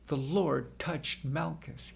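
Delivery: plain reading voice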